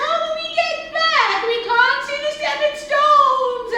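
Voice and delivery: high, loud, anxious wail; worried friends. A narrator's reading. Friends stranded across the river call out, worried. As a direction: cry out in high-pitched alarm. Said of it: "They were worried".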